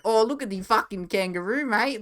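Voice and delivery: high, hammed-up Aussie tourist guide; exaggerated Australian accent; mock Australian accent. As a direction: play a cheesy Australian showing off wildlife.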